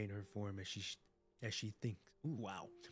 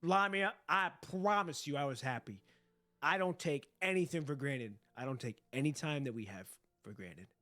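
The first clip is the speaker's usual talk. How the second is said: emotional; 8 dB louder than usual. Real, not acted. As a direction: pour your heart out. Voicing heartfelt reply to character.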